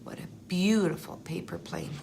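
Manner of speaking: ordinary delivery, nothing marked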